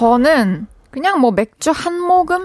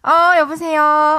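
slow, drawn-out phone greeting; answering the phone; 6 semitones higher, slower than usual. Acted. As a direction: pick up the phone slowly. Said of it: "버전을 두 개 드릴게요".

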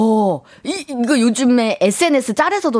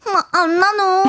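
high, drawn-out mimicry with a laugh; high-pitched mock imitation; imitated speech quirk; 8 semitones higher than usual. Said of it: "그 말투".

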